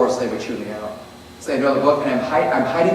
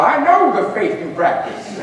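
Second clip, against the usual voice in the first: ominous pastor; 5 semitones higher than usual. Retold or imitated. Pastor voiced menacingly.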